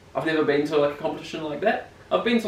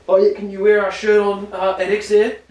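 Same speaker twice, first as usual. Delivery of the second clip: sponsor rep voice; 6 semitones higher, 7 dB louder than usual. Retold or imitated. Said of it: "I've already had the request".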